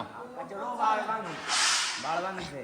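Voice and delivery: learner Gujarati. Real, not acted. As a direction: repeat it like a beginner student. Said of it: "Gujarati ભાષાના class ચાલે છે".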